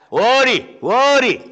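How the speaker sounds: high calls; calling the dog. Acted. Rich man calling his dog to chase the beggar. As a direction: call the dog over.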